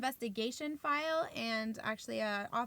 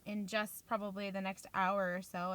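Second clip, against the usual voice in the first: ordinary talk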